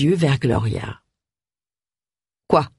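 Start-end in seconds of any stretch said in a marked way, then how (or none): 2.5-2.7 a terse one-word question; curt. A narrator's reading. Man answering his door to an unwelcome neighbour, curt. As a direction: ask it drily, cutting it short. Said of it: "demanda-t-il d'un ton sec".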